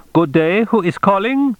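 put-on high-pitched, officious phone clerk with an accent; accented, businesslike male underling, pitched high; brisk accented functionary. A narrator's reading. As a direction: answer like a brisk underling on the consulate switchboard. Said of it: "accented brisk male functionary voice".

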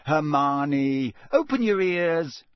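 loud, exasperated call for attention; loud, climbing in pitch. A narrator's reading. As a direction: raise your voice to get through. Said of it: "said Ron loudly".

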